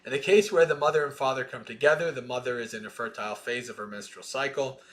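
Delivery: measured, deliberate scripture reading; sutta recitation. Acted. Teacher reading the sutta to students, measured recitation. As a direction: recite each line of the chain steadily.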